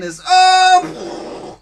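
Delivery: high loud yelp then a deflating puff; shocked cry. Acted. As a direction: cry out then blow air out. Ship crashes into harbour.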